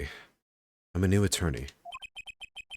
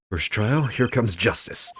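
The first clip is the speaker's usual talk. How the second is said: psyched-up declaration; 4 semitones higher, 6 dB louder, slower than usual. Acted. Lawyer psyches himself up.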